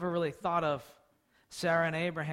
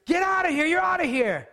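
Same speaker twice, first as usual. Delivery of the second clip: high, loud shout driving the servant off; shouting her out; 9 semitones higher, 6 dB louder than usual. Retold or imitated. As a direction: throw her out with a yell. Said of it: "So what does Sarai do?".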